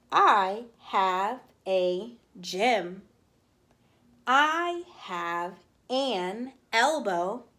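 slow, deliberate reading of a kindergarten poem. Shown as a demonstration. Teacher reading a poem slowly to kindergartners. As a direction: read each line slowly for small children.

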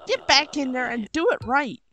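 put-on angry Southern accent. Cook scolding the stupid rat, angry Southern accent. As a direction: tear into them with a Southern twang. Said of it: "this somewhat southern accent".

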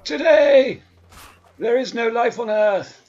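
loud, high war cries; battle shouting. Acted. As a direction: roar it mid-fight. Rampage battle yells.

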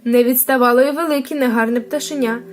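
ordinary delivery

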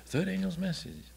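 high, soft puzzled echo of the question; a stranger repeating it, baffled; puzzled passerby. Retold or imitated.

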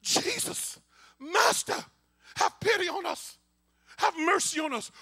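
high, loud plea for mercy; drawn-out pleading shout; crying out loudly. Acted. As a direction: call out to Jesus from afar, desperate. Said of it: "they called in a loud voice".

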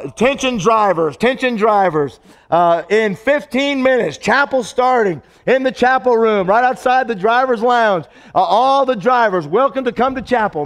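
mock public address call to drivers; loudspeaker announcement. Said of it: "I got on the loudspeaker".

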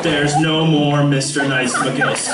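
low, slow, stern teacher; teacher impression. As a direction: drag it out like a mock-stern teacher. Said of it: "he said".

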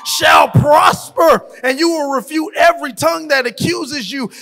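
loud, rising preacher's cadence, building with each verse; full-force revival preaching over the organ. Acted. Rapid-fire promise reading, escalating.

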